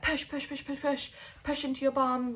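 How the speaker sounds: a midwife lightly urging each push. Retold or imitated. Midwife coaching pushes.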